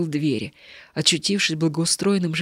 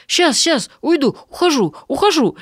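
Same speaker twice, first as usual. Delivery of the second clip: higher, louder flustered gabble; flustered hurry; 9 semitones higher, 6 dB louder than usual. Acted. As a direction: hurry out, fussing and apologetic. Beggar hurriedly leaving.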